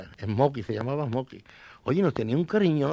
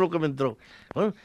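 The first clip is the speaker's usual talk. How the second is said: usual speaking voice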